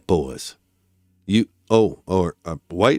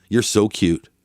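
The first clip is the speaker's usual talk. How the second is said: normal talking voice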